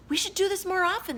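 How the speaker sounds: put-on high, fast pleased kids; gleeful kids. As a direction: chirp it smugly. Kids gloating.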